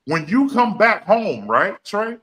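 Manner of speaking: rising preacher fervor; fired-up preaching. Real, not acted. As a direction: preach it like a pulpit climax. Preaching point, raised and loud.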